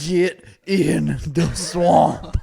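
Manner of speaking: very slow, loud, drawn-out bellow; hammed-up swamp catchphrase. Host remembering Swamp Thing, hammed-up slow bellow.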